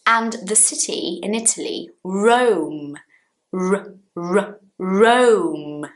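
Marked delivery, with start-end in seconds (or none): 2.0-5.9 "Rome" with a clear English R, sounded alone between; English initial R. Shown as a demonstration. Teacher contrasting it with home, firm English R in "Rome". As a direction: isolate the "r", then say a drawn-out "Rome". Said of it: "not home".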